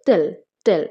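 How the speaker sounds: টেল set apart as one firm beat; stressed syllable alone. Shown as a demonstration. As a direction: isolate টেল, the stressed part of hotel. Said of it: "কোথায় stress পড়ছে?".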